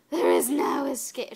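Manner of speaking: badly imitated creepy clone, pushed hard; April. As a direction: ham up a crazed, rough-voiced threat. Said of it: "She doesn't sound like that".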